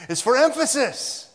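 high, very loud preaching outburst; emphatic. Real, not acted. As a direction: drive it home at full voice. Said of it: "That's what I meant by emphatic".

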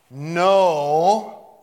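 "Know" belted out high and long; emphatic. Shown as a demonstration. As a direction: boom out a long, loud "Know". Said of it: "It's for emphasis".

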